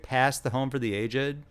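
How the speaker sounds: ordinary delivery, nothing marked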